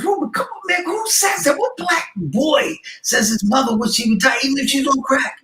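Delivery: high, incredulous pleading; a high disbelieving plea to the kid; shocked plea. Retold or imitated. Pleading with Ruben in disbelief, high and shocked.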